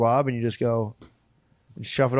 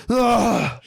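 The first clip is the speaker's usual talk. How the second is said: high mock yelp of alarm; startled yelp; 10 semitones higher, 3 dB louder than usual. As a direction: jolt awake with a yelp. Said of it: "You just wake up like".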